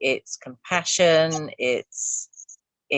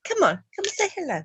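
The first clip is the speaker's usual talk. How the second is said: high, sing-song coaxing; high coaxing call to a cat; coaxing pet voice; 12 semitones higher, 3 dB quieter, faster than usual. Real, not acted.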